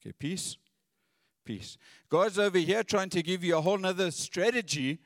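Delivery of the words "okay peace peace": mock single-minded plea. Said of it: "only listening for this one thing".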